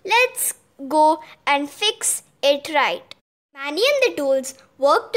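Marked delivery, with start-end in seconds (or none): none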